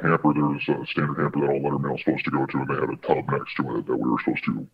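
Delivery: ordinary talk, nothing marked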